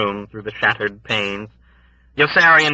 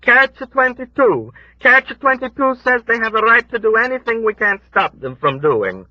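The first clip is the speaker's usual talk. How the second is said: put-on high, lamenting old woman; old woman's voice; 5 dB louder than usual. A narrator's reading. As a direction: voice the grieving old woman, pitched up.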